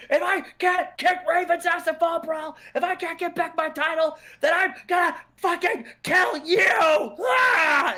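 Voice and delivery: high, loud hammed-up threatening scream; Steiner threatening. Steiner threatening Benoit, mock shouted rage. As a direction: yell it like a raging heel. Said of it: "He says".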